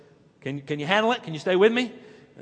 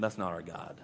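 quiet insistence; 12 semitones lower, 13 dB quieter than usual. Real, not acted. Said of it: "let me tell you something".

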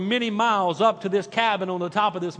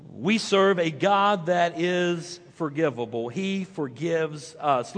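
normal talking voice

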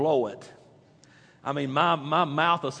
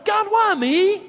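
high rising why me; mock lament; 6 dB louder, slower than usual. Congregation imagined crying out, higher. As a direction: throw up a complaint to God. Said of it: "we look around with".

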